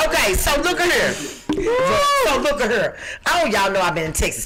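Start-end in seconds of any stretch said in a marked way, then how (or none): 1.1-3.0 very slow, each word stretched; slow drawn-out. Real, not acted. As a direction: drag out So look at here for effect. Repeats phrase, second drawn out.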